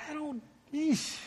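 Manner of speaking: high, soft, exasperated "eesh"; mock dismay. Preacher imagines God watching Israel's fasting, mock dismay. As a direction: pitch it way up in quiet, pained disapproval.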